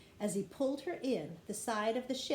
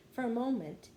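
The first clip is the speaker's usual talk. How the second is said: plain reading voice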